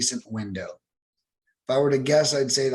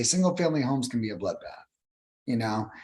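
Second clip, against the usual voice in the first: normal talking voice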